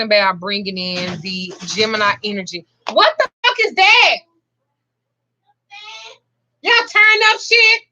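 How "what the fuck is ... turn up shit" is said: real alarm, shouting across the room; startled yell. Real, not acted. Grandmother startled by the kids' crash, yells out loud.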